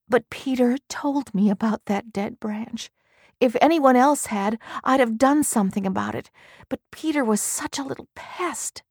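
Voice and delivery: mild anguished confession; lightly voiced anguished wife. A narrator's reading. Marion's voice, bitter.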